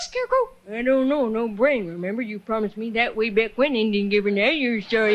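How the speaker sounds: usual speaking voice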